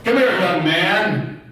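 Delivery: slow, firm dad command; big father voice. Shown as a demonstration. Father summoning his son, a hint of stern. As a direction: call the boy in like a stern father. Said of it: "difference between a mother and a father voice".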